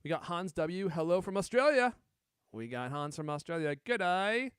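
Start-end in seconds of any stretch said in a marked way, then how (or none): 0.9-2.0 put-on 1 Australian accent. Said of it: "How was that Australian". 3.8-4.5 mock Australian "Good day", higher and louder; a jokey Australian g'day; Australian accent. Greeting an Australian viewer in a mock Australian accent. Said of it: "How was that Australian".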